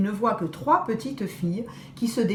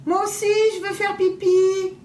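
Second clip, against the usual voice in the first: put-on little girl pleading; 9 semitones higher, 4 dB louder than usual.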